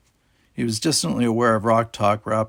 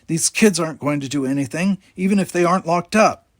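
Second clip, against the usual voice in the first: put-on raised, higher shout at the kids; 7 semitones higher, 4 dB louder than usual. A narrator's reading. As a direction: bark it out, loud and higher. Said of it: "Ross shouted".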